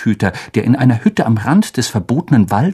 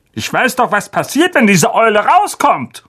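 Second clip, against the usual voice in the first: higher, tense blustering uncle; angry uncle; 10 semitones higher than usual. Acted. Uncle refusing Harry's request, tense and higher. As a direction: bluster at the boy.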